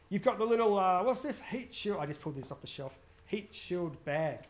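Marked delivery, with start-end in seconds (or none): 3.3-4.4 slow label read. Real, not acted. Reading the label aloud slowly.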